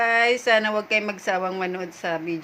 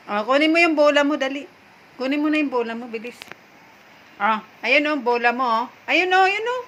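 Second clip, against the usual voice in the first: higher, louder sing-song urging; coaxing the dog; 6 semitones higher, 5 dB louder than usual. Real, not acted. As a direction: cheer a stubborn pet on. Coaxing dog to take ball.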